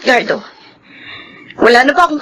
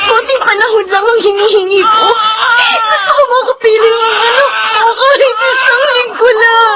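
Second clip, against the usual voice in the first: umiiyak na pagmamakaawa; 10 semitones higher than usual. Acted. Nagmamakaawang ina, umiiyak.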